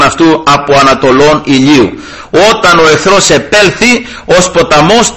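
measured, formal reading of the Bible passage; scripture reading. Acted. Priest reading scripture to the brothers, formal liturgical reading. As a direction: read the holy text steadily to the congregation.

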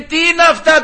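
shrill-pitched mock outrage from the wife; indignant wife. Wife's outraged retort to husband.

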